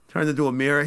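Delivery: ordinary delivery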